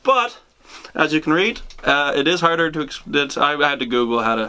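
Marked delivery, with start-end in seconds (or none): none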